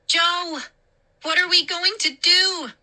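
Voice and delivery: high, fearful plea; frightened. Acted. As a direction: cry out for help, scared. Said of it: "Mindy's frightened voice".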